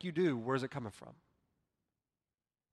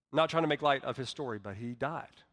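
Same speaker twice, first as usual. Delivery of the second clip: usual speaking voice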